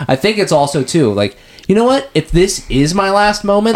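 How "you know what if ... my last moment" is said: high-pitched, determined declaration; acted defiance. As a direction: voice a last stand, pitched well up. Voicing Andrew's defiant last stand.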